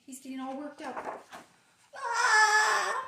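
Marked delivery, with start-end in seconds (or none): 2.0-2.9 short high loud cry of alarm; panicked shriek. Acted. Pigeon panicking about school, high alarmed yelp.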